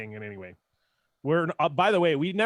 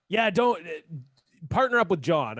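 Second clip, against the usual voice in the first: retold cameo advice; 4 semitones higher, 4 dB louder than usual. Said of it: "he was just like".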